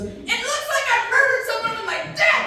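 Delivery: high mock freakout. Guy in the bathroom freaking out, in a high panicked yelp. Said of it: "he goes".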